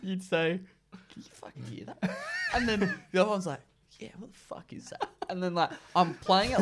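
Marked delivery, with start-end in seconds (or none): 0.9-2.2 hushed, voiceless whisper; imitated whisper. One mate to another during the TV show, whispered. Acting out furtive question on couch. 4.0-5.1 hushed whispered reply; imitated whisper. Other mate answering about the strange sound, whispered.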